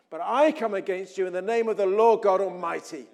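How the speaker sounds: loud, ringing, building to the end; defiant declaration. Acted. David answers Goliath in God's name, defiant and loud. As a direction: proclaim it with full force.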